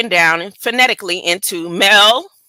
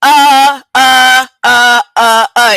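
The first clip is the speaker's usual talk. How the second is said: loud, drawn-out preacher's uh, uh; mock his mother's teaching fillers, louder; a hint of a preaching mother stuck on uh; imitating mother's filler; 5 dB louder, slower than usual. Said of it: "when she teaches or speaks".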